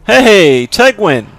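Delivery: ordinary talk, nothing marked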